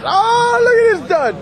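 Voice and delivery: high, drawn-out jeer; jeering exclamation. Real, not acted. Spectator heckling a car at a street race, jeering. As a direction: pitch it way up, stretching the oh.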